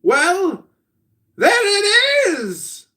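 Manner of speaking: very high, drawn-out exclamation; exaggerated teasing high cry; sleeper's companion. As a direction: call it out theatrically. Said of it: "said the other, laughing".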